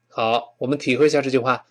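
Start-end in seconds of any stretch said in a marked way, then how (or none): none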